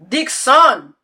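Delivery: ordinary talk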